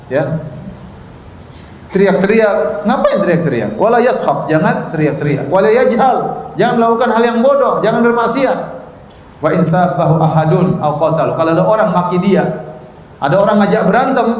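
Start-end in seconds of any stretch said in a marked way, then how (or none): none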